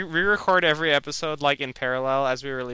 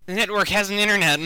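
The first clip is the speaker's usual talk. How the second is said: mock movie quote; 7 semitones higher, 5 dB louder than usual. Quoting a silly movie line.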